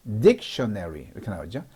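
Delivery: ordinary talk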